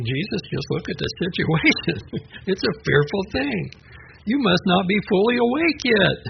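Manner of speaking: high, half-laughing mock protest; imagined retort. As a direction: tease him that he's still half asleep. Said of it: "I would have been thinking".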